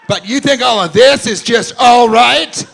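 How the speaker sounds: high, loud and slow, punching this; exasperated parent shouting; mock outraged shout. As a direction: bellow it in disbelief at the family. Comic yelling at family.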